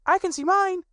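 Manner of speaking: put-on very high, loud, eager child cry; excited little Roo. A narrator's reading. Little Roo spots his stick in the river, crying out high and loud. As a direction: squeal it like a tiny excited kangaroo. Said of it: "cried Roo".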